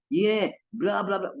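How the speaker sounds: ordinary talk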